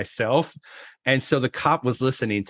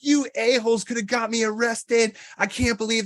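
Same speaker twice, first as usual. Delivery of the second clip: higher, hammed-up outraged rant; mock angry; 10 semitones higher than usual. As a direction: storm in and chew them out. He storms in on the hosts.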